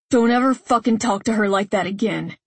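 plain reading voice

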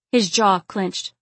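usual speaking voice

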